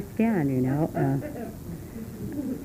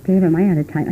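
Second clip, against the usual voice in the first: ordinary talk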